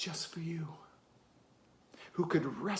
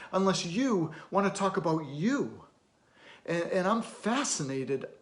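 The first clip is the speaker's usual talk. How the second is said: higher, emphatic, insistent; forceful challenge; 4 semitones higher, 5 dB louder than usual. Acted. Challenging a guarded kid to talk, forceful and insistent. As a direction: press hard, punching the key words.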